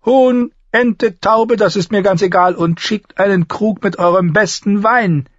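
high, loud, lordly dwarf; commanding dwarf lord. Acted. Dwarf orders food for his men, high and commanding.

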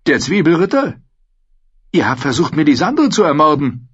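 high-voiced old lord, startled; agitated noble prisoner. A narrator's reading. Old lord recognising his cellmate, startled and accusing. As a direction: play a high-pitched, astonished nobleman.

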